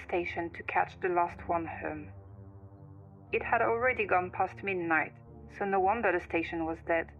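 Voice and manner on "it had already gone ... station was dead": ordinary delivery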